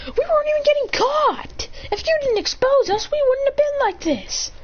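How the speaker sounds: high, indignant mock yell; argumentative retort. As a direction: argue back very high-pitched.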